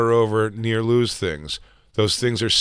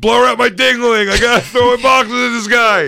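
very high, loud goofy imitation; hammed-up mock yelling, teasing a pal; mocking Lou; 10 semitones higher, 4 dB louder than usual. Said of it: "I don't sound like that".

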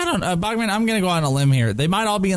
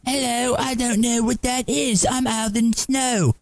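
put-on Aldous Snow, English accent; 7 semitones higher than usual. Co-host playing a British rock star for Bogman, terrible English accent. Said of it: "your terrible Aldous Snow".

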